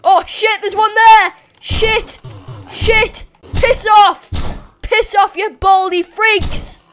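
loud, high alarm turning to abuse; combat shouting. Real, not acted. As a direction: yell to drive the attackers off. Fights off sudden game enemies.

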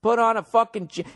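usual speaking voice

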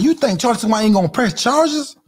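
ordinary talk

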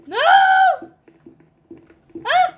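high, loud panicked yell; panicked shout. Real, not acted. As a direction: shout it while running away. Fleeing in game.

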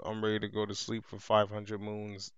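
usual speaking voice

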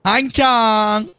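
high, slow singsong nonsense; mock Chinese gibberish. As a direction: fake foreign babble to strangers.

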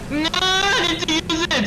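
high, stretched desperate cry; panicked scream. Real, not acted. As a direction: scream frantically as time runs out. Attacked before using doorknob.

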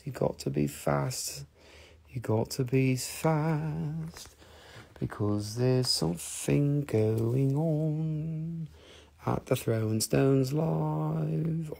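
slow, hushed, stretched-out delivery; drawn-out teaser. Pretend. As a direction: draw every phrase out softly and slowly. Teasing buyers to be fast.

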